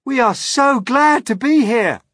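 high, loud, glad call. A narrator's reading. James greeting the crowd from the peach, glad call. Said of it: "James called out".

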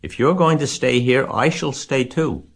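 insistent, climbing high in pitch; shouted protest. A narrator's reading. Wilbur refuses to leave Charlotte to die, shouted protest. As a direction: protest with rising force. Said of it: "shouted Wilbur".

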